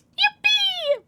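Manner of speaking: cheer. Real, not acted. Cheering a viewer's comment.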